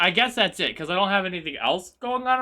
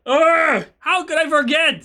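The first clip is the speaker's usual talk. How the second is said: loud, high groan into exasperated cry; frustrated outburst; 7 semitones higher, 7 dB louder than usual. Real, not acted. Streamer who forgot what was planned, loud exasperation. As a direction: groan loudly, then berate yourself. Frustrated at forgetting own plan.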